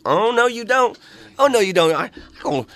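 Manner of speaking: high, sassy mock warning; mimicking the woman. The woman blocking his path, in a high sassy mock voice. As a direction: imitate her blocking him, high and pushy. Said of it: "she's like".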